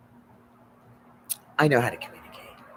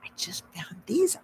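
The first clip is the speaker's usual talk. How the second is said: whispered quote; 12 semitones higher, 3 dB louder than usual. Retold or imitated. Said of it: "And he goes".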